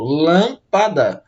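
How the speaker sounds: "Lâmpada" drawn out syllable by syllable; slow teacherly syllable split of "Lâmpada". Shown as a demonstration. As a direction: say "Lâmpada" very slowly, in separate chunks. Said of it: "dividimos as palavras em três sílabas".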